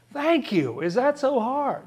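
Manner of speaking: high-pitched, playful exasperated plea; mock exasperation. Preacher envying straight answers from God, mock exasperated. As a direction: make the point in a high, put-upon voice.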